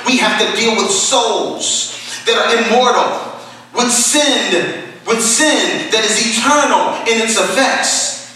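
earnest, emphatic sermon cadence; full-force preaching. Real, not acted. Preacher quoting a call to earnestness, fervent preaching. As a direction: drive each phrase home like a preacher.

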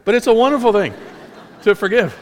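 high, loud, quick, breaking into laughter; real laugh through the words; amused laughing. Real, not acted. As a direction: laugh at your own squeaky slip while insisting. Amused at own voice slip.

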